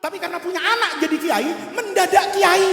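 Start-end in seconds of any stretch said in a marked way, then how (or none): 1.8-2.7 loud, gleeful mock announcement; excited exclamation. Real, not acted. Preacher loudly teasing the parents about becoming kiai, excited shout. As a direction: punch it out loud and high.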